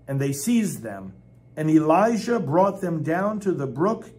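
loud, proclaiming the verses; forceful scripture reading. Acted. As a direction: declare it without softening. Reading Elijah's slaughter passage.

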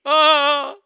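sudden loud high shriek; hammed-up terrified scream; mock scream. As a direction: shriek like you're startled. Mock scream after PTSD claim.

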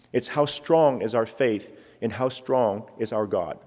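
ordinary talk

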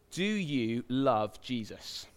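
asked slowly, each word weighed; slow and weighty. Shown as a demonstration. Preacher slowing the question down so it lands deep. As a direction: let the question sink in slowly. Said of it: "it's deep, isn't it?".

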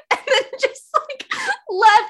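usual speaking voice

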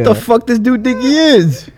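slow, loud and high mock outrage; offended. Owner reliving finding a stranger on his office couch, slow loud mock outrage. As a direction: ham up the indignation, drawn out. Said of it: "at first I was a little offended".

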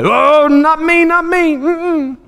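very high, squeaky mock denial; hammed-up wriggling out of an accusation; panicked denial. Peter denying he knows Jesus.

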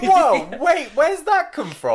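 very high, loud startled protest; laughing disbelief. Real, not acted. Co-host startled by the Salem revelation, high amused surprise. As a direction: cut in, taken aback and amused.

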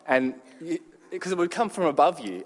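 usual speaking voice

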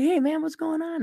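high, light imitation of himself replying; mock greeting back. Retelling his own reply to the fan, high and light. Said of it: "I'm like".

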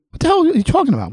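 high, fast, incredulous comeback; retold indignant retort. Host replaying his reaction to friends' warning, indignant. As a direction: fire back in disbelief, pitched high and rushed.

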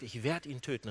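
low, soft deadly vow; restrained wrath. Retold or imitated. Esau full of rage at Jacob, quietly menacing. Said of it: "so einen Zorn in sich".

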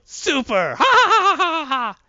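hammed-up loud, high villain cackle; mock supervillain laugh, pealing high; evil laughing. Voicing evil heroine's laughter.

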